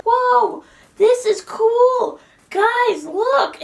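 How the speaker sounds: high, loud cry of amazement; amazed exclamation. Real, not acted. Showing viewers the cool hidden art, amazed high cry. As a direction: call out high and thrilled.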